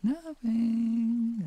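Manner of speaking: high, loud squeaky denial; mock innocent. Streamer playing innocent to chat after swearing, loud high squeak. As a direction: play dumb in a sudden high squeak.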